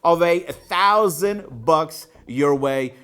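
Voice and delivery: high, loud winner announcement; hyped announcer. Acted. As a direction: call out the winner like a game show host.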